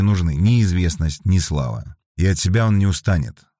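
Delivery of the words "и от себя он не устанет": usual speaking voice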